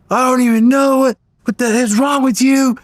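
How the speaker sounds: very high, distraught outburst; imitating crying sister. Crying sister scolding the brother who is leaving, distraught. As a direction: wail the reproach in a high mock-upset voice. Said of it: "She's crying".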